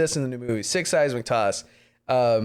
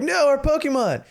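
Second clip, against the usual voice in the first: much higher, dismayed cry of loss; mock wail; 11 semitones higher than usual. Trainers crying out as their Pokemon get taken. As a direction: wail it like losing everything. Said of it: "And they're like".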